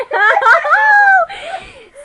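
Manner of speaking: a high, loud burst of laughter; loud shrieking laugh. Real, not acted. At her own joke about being arrested, a loud shrieking laugh. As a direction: crack up out loud.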